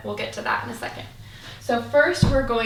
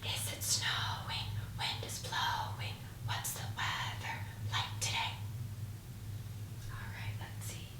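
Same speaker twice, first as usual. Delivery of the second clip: soft whispered singsong; 13 dB quieter than usual. Shown as a demonstration. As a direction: whisper it to the class. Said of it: "We're gonna whisper it".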